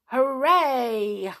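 high, loud, drawn-out hooray; cheering. A narrator's reading. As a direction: cheer like an excited little pixie. Pixies cheer after their adventure.

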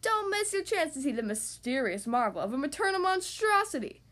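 dramatic circus-barker hype; bellowed sales pitch. A narrator's reading. Little rabbit hawking tickets to the crowd, bellowing. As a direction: relish each word like a barker. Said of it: "Little Rabbit bellowed".

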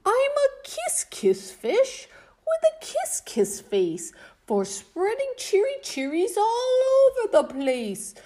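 cheerful fish singsong; joyful kiss-kiss fish. Acted. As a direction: burst out with happy surprise. Fish overjoyed, new refrain.

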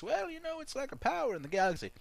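very high, put-on explaining voice; George Lucas. George Lucas telling director about the Force, mock high voice. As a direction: explain it in a squeaky-high quick patter. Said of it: "had a conversation with George Lucas".

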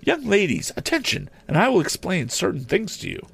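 high-pitched classroom call to order; lady teacher. Acted. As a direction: summon the pupils in a high teacherly voice. Teacher addressing pupils.

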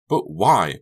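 sharp, loud demand. A narrator's reading. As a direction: fire the question at her. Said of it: "Becca demanded".

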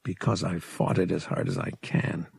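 said under his breath; low, hushed aside; quiet. Acted. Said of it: "he added silently".

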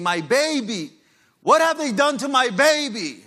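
high, loud anguished wail; imitating a mother's desperate cry. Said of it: "she's crying, and she's saying".

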